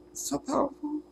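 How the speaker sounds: high, quiet, crying voice breaking; breaking into sobs. Real, not acted. Overwhelmed by revelation, voice breaking into sobs. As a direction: let the sob take the words. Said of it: "I got the snot and everything now".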